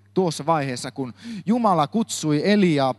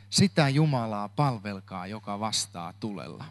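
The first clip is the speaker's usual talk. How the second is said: repeated much softer and lower, sinking to near silence; hushed, reflective echo of the title; quiet repeat; 5 semitones lower, 7 dB quieter than usual. Shown as a demonstration. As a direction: let the title settle, softly and lower.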